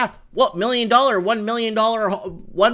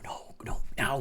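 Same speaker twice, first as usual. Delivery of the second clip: whispered no, no; 4 semitones lower, 10 dB quieter than usual. Real, not acted. Vlogger quietly refusing the fame, whispered. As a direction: breathe the refusals unvoiced.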